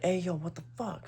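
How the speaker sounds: high, hushed mock yell; voice from behind. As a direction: imitate a man calling out, kept soft. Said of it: "And I hear, like".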